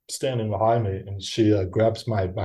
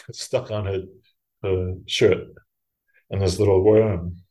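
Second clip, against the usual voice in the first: ordinary talk, nothing marked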